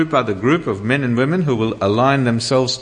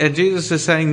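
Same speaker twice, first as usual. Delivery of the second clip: usual speaking voice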